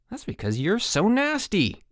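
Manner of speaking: high-pitched shout back. A narrator's reading. Mike hits back at Harold, shouting. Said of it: "shouted Mike".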